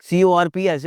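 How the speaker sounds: ordinary talk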